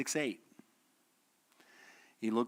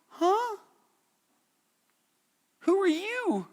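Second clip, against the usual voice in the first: high, startled question; baffled Peter; 6 dB louder, much slower than usual. Retold or imitated. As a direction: gape in confusion.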